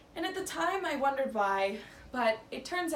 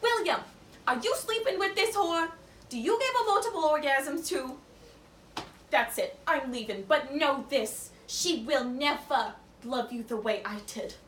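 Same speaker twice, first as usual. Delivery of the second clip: put-on jealous ex-girlfriend; 2 semitones higher than usual. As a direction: play the scorned, crazy ex making a scene. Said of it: "I'm the jealous ex-girlfriend who's stalking him".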